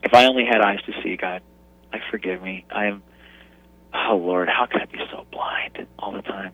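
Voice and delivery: soft, hushed repentant plea near tears; father moved to tears by his daughter, softly begging forgiveness; tearful prayer. Retold or imitated. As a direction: pray it quietly as if holding back tears. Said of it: "I was reduced to tears".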